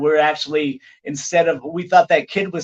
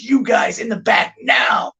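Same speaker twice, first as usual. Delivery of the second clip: gruff order; 7 semitones higher, 3 dB louder than usual. Retold or imitated. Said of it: "he goes".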